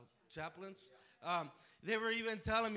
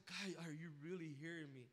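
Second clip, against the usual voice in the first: quiet prayer; 4 semitones lower, 10 dB quieter than usual. Retold or imitated.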